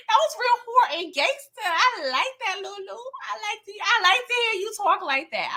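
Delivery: pitched high, giddy praise; a high, giddy mock cheer; excited cheering. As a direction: hype her up, delighted. Cheering Lulu's gangster reply.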